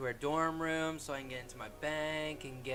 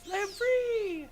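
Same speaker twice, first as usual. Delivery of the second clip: tiny squeaky fairy voice; high, slow, airy fairy cry; pixie; 8 dB louder, slower than usual. Pretend. Freeing a fairy from cage.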